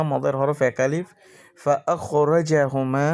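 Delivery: normal talking voice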